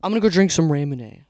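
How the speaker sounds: loud, punchy catchphrase; loud announcing. Real, not acted. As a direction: call it out to the camera. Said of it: "move on to the next, uh, Ramoon clip".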